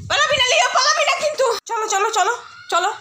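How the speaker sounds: calling the dog back before he runs. Real, not acted. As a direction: stop him from bolting.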